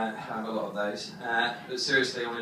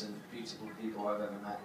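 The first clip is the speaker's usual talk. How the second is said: ordinary talk, nothing marked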